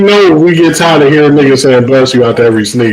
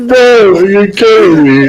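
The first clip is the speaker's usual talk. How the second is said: laughing; 10 semitones higher than usual. Real, not acted. Laughing hard at joke.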